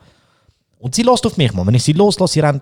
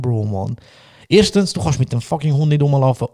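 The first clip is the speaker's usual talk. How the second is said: usual speaking voice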